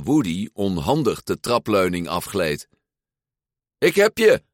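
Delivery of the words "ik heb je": much higher, louder gleeful cry; a boy catching his toy at the stairs; excited call. Acted. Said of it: "riep Andy".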